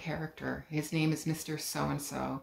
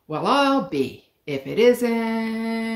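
slow, drawn-out stall, searching for a name; loud, slow, puzzled old shopkeeper; 6 semitones higher, 7 dB louder, much slower than usual. Acted. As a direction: drag it out, like the name is on the tip of your tongue. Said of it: "He stalled like this".

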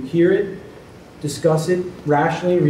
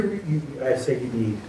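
ordinary talk, nothing marked

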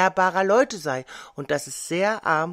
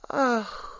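sighing; 6 semitones higher than usual. A narrator's reading. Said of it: "seufzt das Kind".

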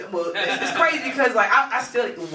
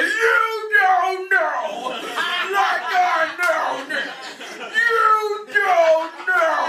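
high, drawn-out wailing rant; imitating arguing crackhead; 10 semitones higher, much slower than usual. Crackhead arguing with the sidewalk, slow high wail. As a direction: howl each word very slowly. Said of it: "a crackhead arguing with the sidewalk guy".

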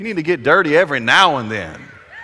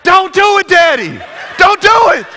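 put-on much higher, loud panicked child's shout; panicked kids; 9 dB louder than usual. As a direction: scream at Daddy to stop, terrified. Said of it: "fear grips my children".

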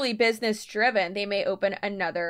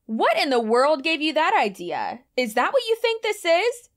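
incredulous; 7 semitones higher, 4 dB louder than usual. Shown as a demonstration. Acting out the script's reply to a prospect, incredulous. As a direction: pitch climbing high in disbelief. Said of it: "in an incredulous way".

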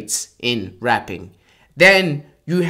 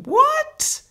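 high squeak of mock disbelief; squeaky what. Real, not acted. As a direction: shoot what way up in amazement. Disbelief at Harvey's voice.

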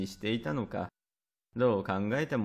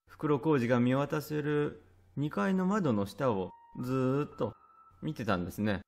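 ordinary delivery, nothing marked

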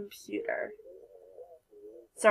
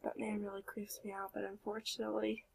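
much softer, fast breathy aside; whisper; 2 semitones lower, 16 dB quieter, faster than usual. Real, not acted. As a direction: hush it like a secret. Creepy name, hushed aside.

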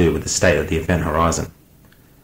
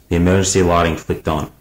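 ordinary delivery, nothing marked

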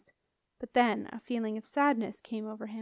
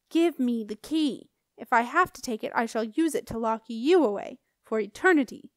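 menacing guardian; 5 dB louder than usual. Acted. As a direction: lean on each word like a jailer. Guardian threatens Jenna.

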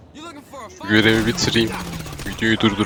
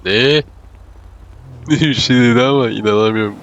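surprised; 4 semitones higher, 6 dB louder than usual. Real, not acted. Sudden shock in game.

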